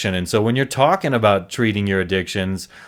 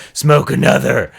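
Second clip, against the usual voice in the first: louder, demented growl of craving; tempting inner voice; 2 semitones higher, 5 dB louder than usual. Acted. His mind urging him on though he feels sick, demented growl. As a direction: growl it as an insistent urge. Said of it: "my mind's telling me".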